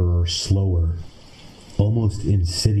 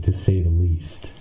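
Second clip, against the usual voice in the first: plain reading voice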